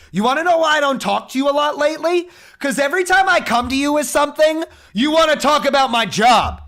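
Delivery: high, loud furious rant; yelling at dad. Retold or imitated. Son screaming at his dad over the phone, furious and high. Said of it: "I literally started yelling".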